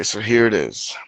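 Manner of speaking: ordinary talk, nothing marked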